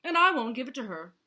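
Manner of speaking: fast, loud, high refusal; grumbling. A narrator's reading. Vulture refuses before Yak and Zebra, loud grumble. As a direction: snap out the refusal loudly. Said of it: "grumbled Vulture".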